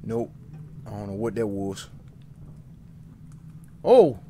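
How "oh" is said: startled yelp. Real, not acted. Startled in game.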